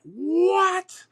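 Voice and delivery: very high, loud disbelieving what; shocked what. Retold or imitated. Son learning his mom drove half an hour away, shocked shout.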